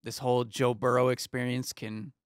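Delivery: ordinary talk, nothing marked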